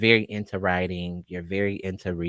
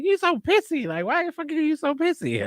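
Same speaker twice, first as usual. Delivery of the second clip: much higher mock brother yelling; angry brother. Brother mocking the kid who wet the bed, pitched high. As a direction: mimic the older brother scolding, pitched way up. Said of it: "he's like".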